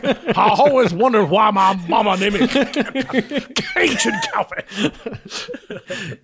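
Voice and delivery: slow, hammed-up Cajun drawl through laughter; Cajun accent. Playing the Cajun waiter, laughing.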